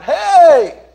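loud high call across the hill; hollered hey. Acted. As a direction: shout it out to Saul far away. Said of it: "he hollered".

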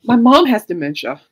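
fighting back tears while explaining to friends; choked up. Real, not acted. Apologizing to friends after tearing up, choked up. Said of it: "I'm trying not to cry".

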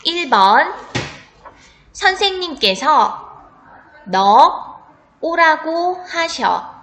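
spelling test read; dictating. Shown as a demonstration. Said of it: "문제는 딱 두 번 들려줄 테니까 잘 듣고".

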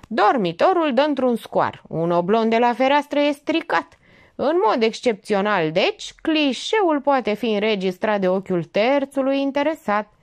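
high, emphatic briefing; cocksure informant. Acted. Young man sets out the surveillance plan, cocksure. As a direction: announce each stage with complete assurance.

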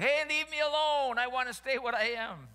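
loud, high-pitched yell as talking clay; hammed-up wail of objection; protesting clay. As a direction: play the clay crying out in protest. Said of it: "the clay is going".

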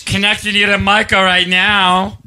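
high, drawn-out operator singsong; old-time telephone operator. Acted. Co-host connecting the call as operator, switchboard voice. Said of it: "the operators with all the wires".

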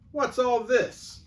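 high, slow official query; policeman. Acted. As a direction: draw the question out firmly. Policeman asks again.

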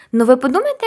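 ordinary talk, nothing marked

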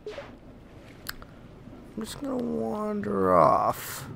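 slow, soft sarcastic mutter; sarcastic aside. Pretend. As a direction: drawl it out as a joke. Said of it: "Do exactly the opposite of what you say".

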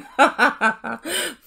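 hearty ha-ha at your own pun; amused cackle; real laugh. Real, not acted. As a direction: burst out laughing mid-joke.

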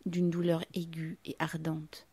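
ordinary delivery, nothing marked

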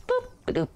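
high playful boop; silly high booping. Pretend. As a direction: chirp it.

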